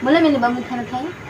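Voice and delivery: quiet lisped prompt; put-on lisp. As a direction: softly coax in baby-talk consonants. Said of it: "तोतलाते ही तो बोल रही हूँ".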